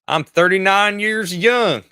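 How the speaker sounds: put-on high, drawn-out old-timer; proud old-timer announcing an age; old person's voice. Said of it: "say it in an old person's voice".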